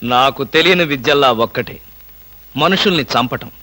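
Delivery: low, quiet mock menace. Husband lowers his voice to needle the boss, mock menace. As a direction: drop the voice for a fake threat.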